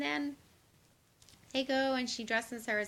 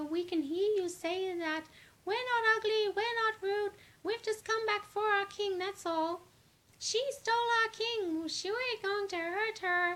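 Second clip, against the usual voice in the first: much higher, louder whiny voice; high squeaky protest; 12 semitones higher, 6 dB louder than usual. Retold or imitated. Mercurians whine that they can hear the insults. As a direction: squeal it out, offended. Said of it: "And they go".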